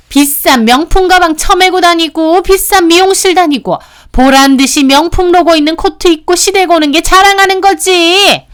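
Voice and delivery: high, loud tirade of accusations; 따지는 시누이 고함. Acted. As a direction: rant at her as the resentful sister-in-law. Sister-in-law accusing in quarrel. (높고 큰 목소리로 몰아붙이는 시누이)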